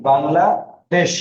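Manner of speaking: slow, stretched Bangladesh, syllables drawn apart; teacher modelling pronunciation slowly; sounded out slowly. Shown as a demonstration. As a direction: draw out Bangladesh for the class. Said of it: "উচ্চারণটা কি?".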